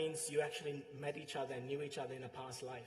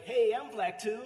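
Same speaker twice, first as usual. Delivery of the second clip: high, loud comeback; imitated loud protest, pitched right up; mock protest; 8 semitones higher, 11 dB louder than usual. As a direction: pipe up in playful indignation. Retelling Susan's indignant comeback.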